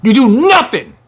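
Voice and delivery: slow, high, thundering rebuke; preaching at full force. Real, not acted. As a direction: hammer each word home. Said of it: "I'ma say it again".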